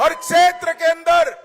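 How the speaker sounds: हर क्षेत्र के अंदर hit high and loud; emphatic rally line. Acted. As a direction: punch क्षेत्र with a falling close. Repeated rally phrase.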